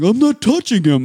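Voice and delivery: put-on high, loud protesting child; loud, high-pitched mock protest; mock defiant kid. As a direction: imitate a kid insisting on innocence. Defiant kid answering teacher.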